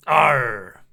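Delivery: low mock pirate arr; pirate growl. Streamer greeting a raider named Fanboy Pirate, low pirate arr. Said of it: "Talk Like a Pirate Day".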